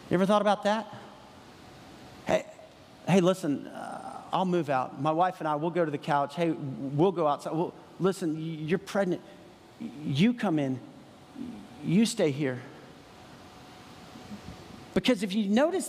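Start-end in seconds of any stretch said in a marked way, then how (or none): 2.2-12.6 lower, gentle host giving up his bed; selfless host. Retold or imitated. Imagined host tells pregnant Mary to come in, lower. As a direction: offer your own bed, lower.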